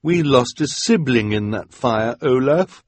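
lightly voiced bitter accusation; accusing woman. A narrator's reading. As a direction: confront Olaf.

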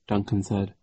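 ordinary delivery, nothing marked

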